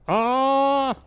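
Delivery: high, loud sustained ah; grandma's cry. Acted. Grandma in the noisy tree lets out a high, loud cry.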